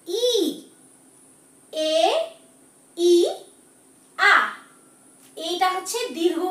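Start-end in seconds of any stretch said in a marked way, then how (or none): 0.1-0.3 ই held as a long vowel. Shown as a demonstration. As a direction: stretch the ই out for learners. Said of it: "উচ্চারণটা একটু দীর্ঘ". (ই টেনে দীর্ঘ করে বলা)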